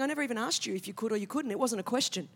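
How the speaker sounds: a weary God brushing off excuses; God, eye-rolling. Retold or imitated. God answering the speaker's excuses, exasperated and low. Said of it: "God's kind of rolling his eyes".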